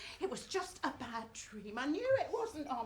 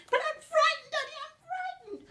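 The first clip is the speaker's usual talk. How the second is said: put-on much higher, louder wailing girl; frightened child; 13 semitones higher, 8 dB louder than usual. Little Betsy telling her daddy she's scared, high wail. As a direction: cry out high like a terrified girl.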